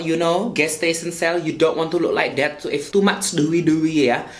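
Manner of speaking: big-city Indonesian street-slang English; Javanese-mixed Indonesian accent. Shown as a demonstration. Vlogger teaching viewers about primer, Javanese-mixed Indonesian accent. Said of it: "the big city Javanese mixed accent".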